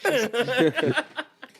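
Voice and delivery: bright loud laugh; high burst of laughter. Real, not acted. Host agreeing with the cohost, loud high laugh.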